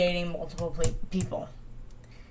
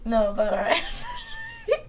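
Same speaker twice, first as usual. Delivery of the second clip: high laughing shout. Real, not acted. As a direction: laugh it out, exasperated. Laughing exasperated correction.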